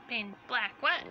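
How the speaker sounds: ordinary delivery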